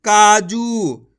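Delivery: काजू with both vowels held very slowly; slow drawn-out word. Shown as a demonstration. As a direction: stretch every vowel of काजू. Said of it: "खींच के पढ़ेंगे".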